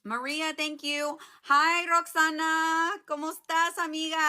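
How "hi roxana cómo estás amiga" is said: high, drawn-out happy hello; excited greeting. Real, not acted. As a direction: sing out a slow greeting. Greeting a friend on the live.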